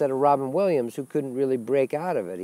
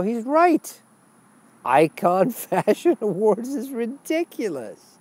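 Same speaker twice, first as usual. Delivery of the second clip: much higher, laughing sarcastic voice; mocking exaggerated outrage; 7 semitones higher, 4 dB louder than usual. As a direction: sneer at celebrity shallowness with mock glee. Mocking fashion awards in put-on voice.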